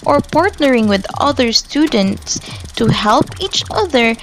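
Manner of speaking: normal talking voice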